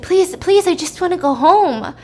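high, loud opening plea; acted desperate pleading. Captive woman begs the man to let her go home, desperate pleading. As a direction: beg in mock desperation, starting high.

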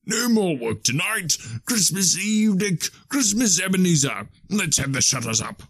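high, jolly calling voice; jovial old master. Acted. Fezziwig ends work for Christmas Eve, jovial high cry. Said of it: "cried old Fezziwig".

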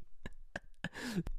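airy, high burst of laughter; breathy high laugh. Real, not acted. Telling friends a story, breathy laugh. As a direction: laugh silently at the memory.

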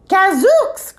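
high-pitched exclamation of shock; startled cry. A narrator's reading. Walrus finding a cat on his table, startled cry. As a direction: cry out in comic alarm. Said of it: "cried the walrus".

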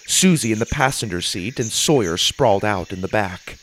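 plain reading voice

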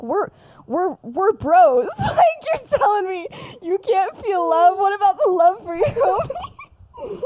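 high, loud, hammed-up outrage with laughter; mock shocked. Sirius hurt that his bro can't feel love, mock shocked. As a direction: wail it in mock offence, cracking up. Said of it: "shocked that Remus even half-heartedly believes".

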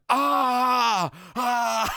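high, loud hammed-up scream; mock screaming. Dazed classmate waking up screaming at the teacher, mock loud. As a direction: scream like you just woke up terrified. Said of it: "the lad started screaming".

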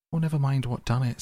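high, pained complaint; acted hurt, pitched well up. A narrator's reading. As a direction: brush it off crossly through the pain. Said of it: "forgetting his grammar in his pain".

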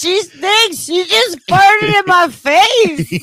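put-on very high, loud tattling toddler; three-year-old's voice. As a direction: announce it loudly in a small child's piping voice. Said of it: "Out of a three-year-old voice".

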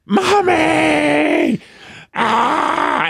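put-on high, drawn-out wail of a furious toddler; angry child's scream. Dad imitating his daughter refusing bedtime, angry child's scream. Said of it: "then it was pure anger".

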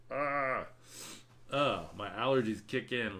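ordinary talk, nothing marked

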